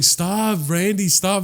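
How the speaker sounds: high, playful telling-off; teasing high-voiced protest; flirty mock protest.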